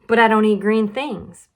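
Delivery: put-on child protesting green food; stubborn little girl. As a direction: dig your heels in. Lola refuses green food.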